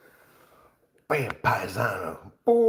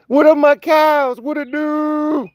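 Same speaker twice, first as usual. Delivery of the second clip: much higher, slow put-on greeting; cows greeting; 9 dB louder, slower than usual. Greeting his cows in a much higher, slow playful voice. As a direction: croon a hello up high and slow.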